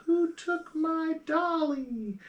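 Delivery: put-on very high, slow child's voice; a creepy little girl asking; drawn-out eerie kid voice; ghost child. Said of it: "a kid going".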